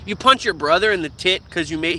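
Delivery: ordinary delivery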